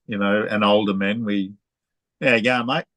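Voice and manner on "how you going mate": "How you going, mate?" squeezed into a few run-together syllables; broad Aussie slurring. Shown as a demonstration. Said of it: "that's how we talk to each other, especially men".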